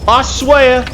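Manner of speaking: high, loud, yelling put-on country accent. Player yelling at blocked traders in put-on country accent. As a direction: shout at the stuck carts in a hammed-up country accent. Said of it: "I can't do that accent for that long".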